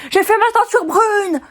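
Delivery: high, slow, loud protest; indignant outburst. Real, not acted. Protesting to viewers about her dyed hair, high and loud. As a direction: cry it out, outraged. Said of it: "je m'énerve".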